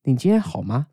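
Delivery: 北京腔调的你今天好吗？; Beijing intonation. Retold or imitated. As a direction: imitate a Beijing friend asking 你今天好吗？. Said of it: "北京人可能会说".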